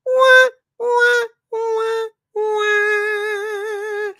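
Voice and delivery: high wailing wah wah; a sad trombone done as a high mock cry. Streamer mocking the joke with high wailing wah wah.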